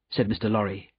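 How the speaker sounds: usual speaking voice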